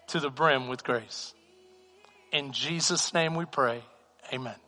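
soft, low pastoral prayer; closing prayer. Acted. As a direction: pray over them gently.

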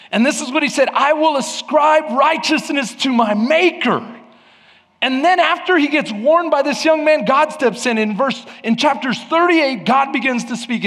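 high, driving pulpit delivery; full-force preaching. Real, not acted. Preacher telling how God steps in, full-force preaching. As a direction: proclaim it to the whole room.

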